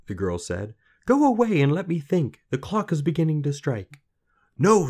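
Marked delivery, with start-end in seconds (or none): 1.0-4.0 high, loud, anxious rebuff; impatient girl. A narrator's reading. Girl to lad near midnight, frantic. 4.6-4.9 high, loud insistence; breathless lad, urgent. A narrator's reading. Lad to girl, breathless urgency.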